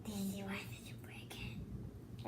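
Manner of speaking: whispered complaint; hushed, breathy whisper. Real, not acted.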